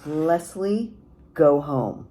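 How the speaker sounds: loud stern order to leave; angry mother. A narrator's reading. Mother ordering the neighbor boy to leave, loud and angry. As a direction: send him off with force.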